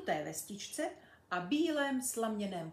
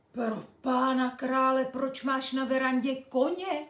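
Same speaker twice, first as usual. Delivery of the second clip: louder, astonished cry; amazed exclamation; 4 semitones higher, 8 dB louder than usual. Acted. Tommy amazed at the horse on the veranda, loud surprise. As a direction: gasp it out in wonder. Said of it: "divil se Tommy".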